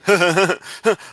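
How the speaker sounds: loud high burst of laughter; laughing. Real, not acted. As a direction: laugh out at him. Mocking the caller's money claim.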